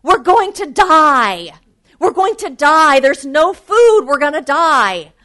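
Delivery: shouted, high, panicked cries; panicked yelling. A narrator's reading. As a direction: scream it like a man stranded with no hope. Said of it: "one of them started screaming and yelling".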